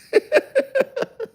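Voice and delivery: short high-pitched giggle. Real, not acted. To viewers, giggling at his own act, high-pitched. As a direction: laugh at your own performance.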